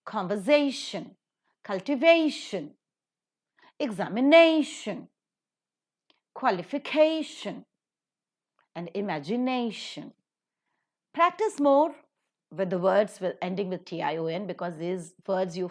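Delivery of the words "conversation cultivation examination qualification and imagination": Conversation, cultivation, examination, qualification, imagination, each accented on the A before -tion; stress before shun. Shown as a demonstration. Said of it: "the is given the stress".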